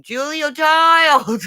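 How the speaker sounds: high, drawn-out exclamation; loud, stretched-out cry of recognition; triumphant recall. Real, not acted. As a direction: crow the name as it comes back to you. Excitedly recalls the forgotten name.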